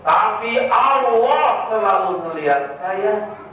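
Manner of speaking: high, sweet devoted wife; pious wife voice. Acted. Preacher voicing a pious wife, lightly high. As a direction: speak as a sincere pious wife.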